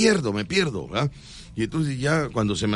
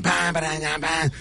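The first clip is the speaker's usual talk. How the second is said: vocalized drum-and-fanfare hits; imitated show intro; 4 semitones higher than usual. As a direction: mouth the band's opening bursts. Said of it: "Y empiezan".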